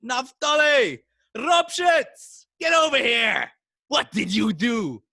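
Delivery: high, loud shout of a name; screaming out. Retold or imitated. Rebbe screaming for his mischievous student, shouting. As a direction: bellow for the student across the house. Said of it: "screamed out".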